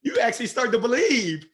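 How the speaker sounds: loud, high, building "I am somebody"; rising motivational fervor. Real, not acted. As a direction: lift the room with belief.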